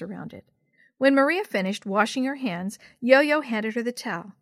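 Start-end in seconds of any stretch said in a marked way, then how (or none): none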